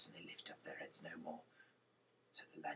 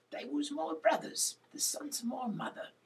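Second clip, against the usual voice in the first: much higher, louder whispered cry of grief; Gideon's grief; 10 dB louder than usual. Acted. Gideon telling the kings they killed his brothers, high pained voice.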